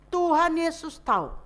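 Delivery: ordinary talk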